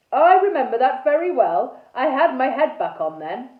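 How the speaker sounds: loud, emphatic character voice; Sir Horace. A narrator's reading. Sir Horace insists to Araminta that he remembers, loud and emphatic. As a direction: speak up as an indignant old acquaintance.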